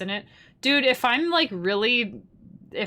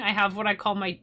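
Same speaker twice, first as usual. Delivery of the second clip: ordinary delivery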